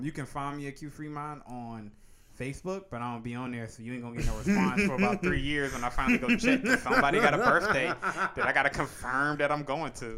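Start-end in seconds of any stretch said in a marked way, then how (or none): 4.2-6.6 loud laughing delivery. Real, not acted. Laughing at his own joke. 6.6-10.2 higher, louder laughing words. Real, not acted. Laughing at his own joke.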